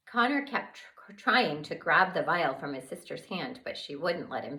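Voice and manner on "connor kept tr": ordinary delivery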